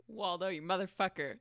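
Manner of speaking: normal talking voice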